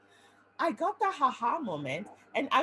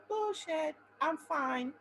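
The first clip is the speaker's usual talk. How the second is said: higher, scoffing brush-off; retelling her own denial of the diagnosis, higher and scoffing; dismissive denial; 6 semitones higher than usual. Retold or imitated. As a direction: wave it away, pitched up. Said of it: "I was like".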